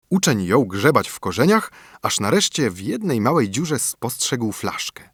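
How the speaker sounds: ordinary delivery, nothing marked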